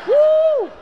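high, loud whoop; excited whoop. Retold or imitated. Kids whooping as Mum's hairspray goes in, high and loud. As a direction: cheer as the big cans go in.

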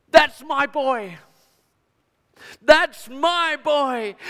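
slow, loud, high cry of joy; proud father calling out his joy; joyful cry. Acted. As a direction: let the father's joy burst out. Father recognising his son.

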